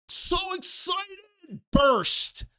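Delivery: high slow stretched so excited, then shouted burst; mock burst.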